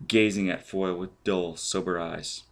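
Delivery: ordinary delivery, nothing marked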